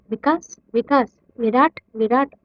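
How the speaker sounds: usual speaking voice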